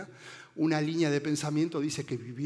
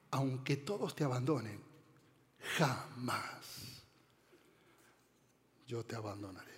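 soft, sinking lower with long pauses; hushed solemn vow; 4 semitones lower, 8 dB quieter than usual. Retold or imitated. Preacher quoting a disciple's promise to his Lord, hushed and solemn.

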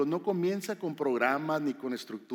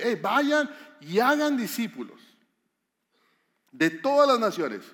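emphatic command; 5 semitones higher, 6 dB louder than usual. Shown as a demonstration. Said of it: "les dice". (orden enfática, más aguda, fuerte y lenta)

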